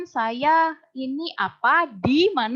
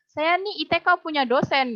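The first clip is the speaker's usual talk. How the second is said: Chinese Indonesian speech; 6 semitones higher, 3 dB louder than usual. Retold or imitated. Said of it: "orang Chinese ngomong di dalam bahasa Indonesia".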